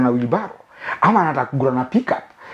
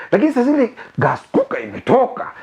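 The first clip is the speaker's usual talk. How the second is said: normal talking voice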